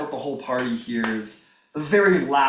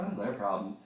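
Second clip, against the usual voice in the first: low, hushed bystander's grumble; much softer, lower puzzled aside; muttered aside; 4 semitones lower, 11 dB quieter than usual. Acted. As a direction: say it under your breath about noisy neighbours.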